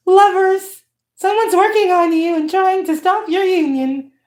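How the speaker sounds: high, airy put-on delivery; message-giver voice. Reader addressing lovers with a warning, in a high put-on voice. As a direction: lift it well up, as if channelling for lovers.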